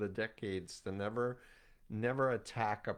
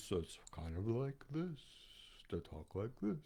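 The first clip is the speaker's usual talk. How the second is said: hypnotic velvet voice; 8 dB quieter than usual. Shown as a demonstration. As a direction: lull them like a hypnotist. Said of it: "I deliberately did not want to use my hypnotic velvet voice".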